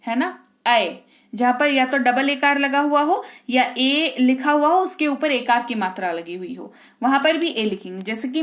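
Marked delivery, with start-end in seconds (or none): none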